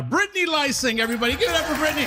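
high, rousing announcer shout; headliner introduction. Real, not acted. Host bringing comedian up to the stage, rousing high announcer shout. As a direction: call out high to whip up the crowd.

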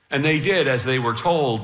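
usual speaking voice

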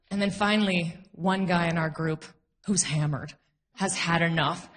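ordinary talk

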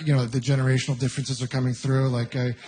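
normal talking voice

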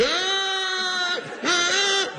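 gasping yell. Retold or imitated. Comic mimic of a swimmer gasping for breath. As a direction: gulp air like Phelps out of the pool.

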